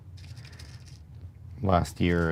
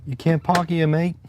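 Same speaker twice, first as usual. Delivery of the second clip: bad Cockney accent; 9 semitones higher than usual. Shown as a demonstration. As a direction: toss off a jokey Cockney. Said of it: "Like that".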